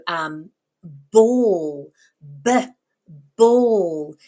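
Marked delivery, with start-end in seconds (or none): 1.1-4.1 "ball" loud and drawn out, "b" a short buh; first-sound demo. Shown as a demonstration. Teacher models initial sound, loud "ball", short separate "b". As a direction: alternate long "ball" with an isolated buh for "b". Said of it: "identify the first sound in the word".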